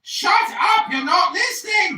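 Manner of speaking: high piercing furious shout; shrill anger. Acted. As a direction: scream it over them. Yells down interrupting guest.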